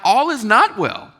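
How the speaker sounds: hammed-up outrage at her answer; incredulous. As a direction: react like you can't believe her. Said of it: "I was like".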